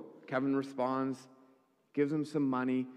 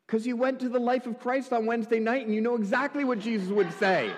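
loud, high rapid-fire rush; mock enthusiasm; 9 semitones higher, 5 dB louder than usual. Answers own question in mock enthusiasm.

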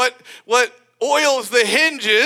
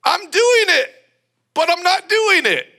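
wry paradox; 8 semitones higher than usual. Retold or imitated. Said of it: "you literally can say".

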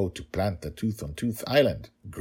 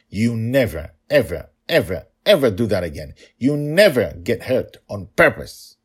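louder, firm warning, finger raised; stern warning; 3 semitones higher, 8 dB louder than usual. Acted. Father forbidding his daughter to get hurt, firm and loud. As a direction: lay down the law, no argument.

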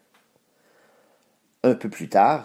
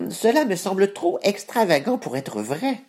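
cat voice; 9 semitones higher than usual. Acted. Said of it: "ajouta le chat botté".

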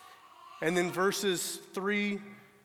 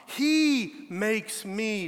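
"He" hit slowly and firmly; "He makes me", the "He" stressed; 3 semitones higher, 5 dB louder, much slower than usual. Shown as a demonstration. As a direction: punch the word "He", slow it right down. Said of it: "Do you notice the emphasis on that?".

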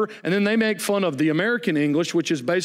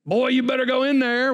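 Southern parent scolding; 7 semitones higher than usual. Retold or imitated. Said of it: "it's dialect".

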